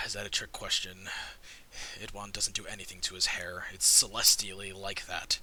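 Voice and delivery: low, quiet, unamused reply; dry, low-voiced exasperation; weary sigh. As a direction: answer with tired patience after a sigh.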